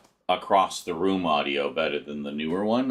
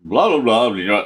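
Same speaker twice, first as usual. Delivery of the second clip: loud mic test; 3 semitones higher, 10 dB louder than usual. Shown as a demonstration.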